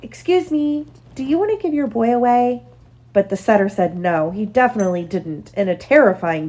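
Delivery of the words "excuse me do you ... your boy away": polite calling out. A narrator's reading. Puppy asking a setter after a cross refusal, polite high-pitched call. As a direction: politely call out a careful request. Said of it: "called out politely".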